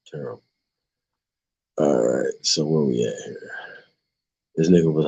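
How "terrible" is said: breathy whispered dismay; whispered sigh. Real, not acted. Reacting to the story, a whispered sigh. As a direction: sigh the word out under the breath.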